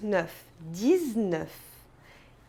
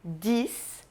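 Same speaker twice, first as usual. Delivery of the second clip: ordinary delivery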